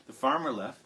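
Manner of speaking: usual speaking voice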